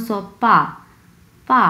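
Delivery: পা with a firm falling tone; fourth tone. Shown as a demonstration. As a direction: drop the pitch firmly on পা. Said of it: "প্রথম অংশ হচ্ছে চার number tone".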